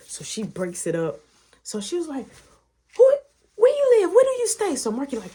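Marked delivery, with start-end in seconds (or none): 3.0-4.8 loud, high interrogation; shrill mock-mother demand. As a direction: imitate an outraged mama shouting questions. Mama demanding, acted.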